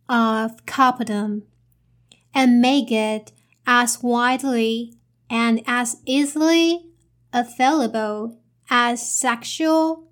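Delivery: slow, chunked reading with pauses between phrases. Shown as a demonstration. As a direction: read it out slowly in short chunks, pausing like a teacher. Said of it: "repeat the sentence again".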